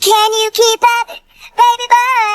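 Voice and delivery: high, teasing mock-female voice; imitated woman's voice. Son imitates dad's lady friend to dad, high mock voice. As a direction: pitch it up, drawing out the end. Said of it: "And she said".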